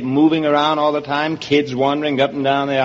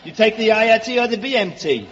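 high, needling Brooklyn voice; Brooklyn accent; 6 semitones higher than usual. Acted. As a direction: play the hammed-up Brooklyn native. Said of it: "let's get out of Brooklyn".

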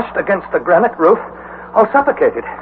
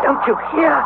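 higher, urgent plea; frightened; 11 semitones higher than usual. Acted. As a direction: beg him to listen. Frightened nephew.

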